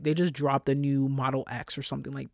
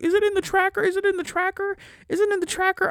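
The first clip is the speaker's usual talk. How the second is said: much higher, frantic repeated mock panic; panicky colleague; 4 dB louder than usual. Mocking colleagues panicking about the tracker, squeaky and frantic. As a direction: squeak it out like a nervous wreck. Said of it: "They're like".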